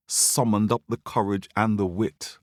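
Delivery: ordinary delivery, nothing marked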